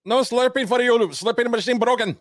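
very high, loud silly robot voice; squeaky machine voice, hammed up; high mock voice.

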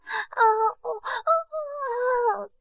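high, drawn-out moan; long, high-pitched performed moaning; acted pleasure moan. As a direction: let out long rising moans. Aroused moaning in erotic narration.

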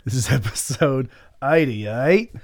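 slow, playful Australian accent; amateur Australian accent with a chuckle. Shown as a demonstration. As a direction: draw out the number like an Australian. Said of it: "I can say in an Australian accent".

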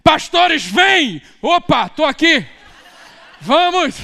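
high, loud shouted command; military order. Retold or imitated. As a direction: bark it like a drill sergeant to the pastors. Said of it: "ele dá a ordem militar dele".

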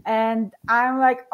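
normal talking voice

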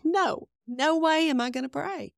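high, indignant no way; retold outburst of refusal; defiant refusal. Refusing God, retold protest.

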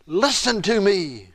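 slow, earnest plea; pleading prayer. Retold or imitated. David crying out to God, slow pleading. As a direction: beg to be heard, drawn out. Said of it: "David is crying out to God".

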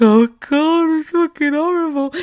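high, loud, breaking wail of distress; hysterical crying. Retold or imitated. On the phone to her husband, wailing in hysterical tears. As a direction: sob it out down the phone, barely holding together. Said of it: "literally being hysterical".

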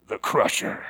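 low, hushed unveiling of a monster; low, ominous reveal. Shown as a demonstration. Preacher revealing the truck's name, low and ominous. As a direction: drop low and soft on the name.

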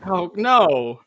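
loud, high-pitched playful objection; mock protest. Real, not acted. Host bantering with co-hosts over Burn Notice, mock protest. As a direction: argue back in mock outrage.

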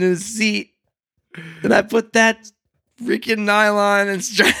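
slow, laughing ruefully; chuckling through the words; laughing while talking. Real, not acted. Laughing over costly seat.

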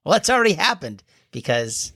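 ordinary delivery

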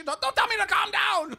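very high, loud imitated screech; mimicked hysterical screaming retort; losing her mind. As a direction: shriek back as if losing it. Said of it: "losing her mind".

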